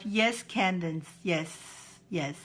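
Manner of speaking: ordinary talk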